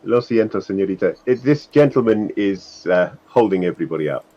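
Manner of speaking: ordinary delivery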